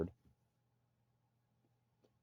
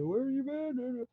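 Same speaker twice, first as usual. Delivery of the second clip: much higher, slow, put-on voice; mock entourage greeting; much slower than usual. Mocking a beach entourage, high slow put-on greeting. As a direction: drag it out like a fawning hanger-on.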